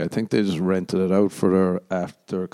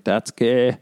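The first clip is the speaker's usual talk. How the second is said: inner Irish voice; 3 semitones higher than usual. Retold or imitated. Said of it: "the old Irish voice in my head".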